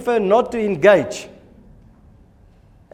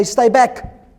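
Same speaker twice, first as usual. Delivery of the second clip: mock warning; 7 semitones higher, 4 dB louder than usual. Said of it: "sort of say".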